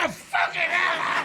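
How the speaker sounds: high, slow laughing cry of pain; pained yell. Retold or imitated. Comic alone in dressing room, laughing pained yell. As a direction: let the hurt out once alone. Pain after headbutt backstage.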